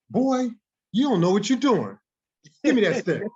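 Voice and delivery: high, loud retold reprimand; mock scolding teacher. Retold teacher scolding his student.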